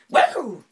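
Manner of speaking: high, very loud "woo" holler; hillbilly whoop. Shown as a demonstration. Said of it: "probably the hick".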